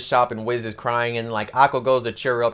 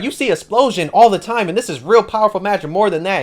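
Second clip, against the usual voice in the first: high, insistent jealous protest; put-out and envious, pitched up; 6 semitones higher, 4 dB louder than usual. Retold or imitated. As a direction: insist with mock indignation, voice raised. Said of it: "that's when Magnum gets jealous".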